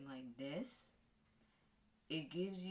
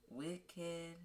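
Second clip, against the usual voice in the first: ordinary talk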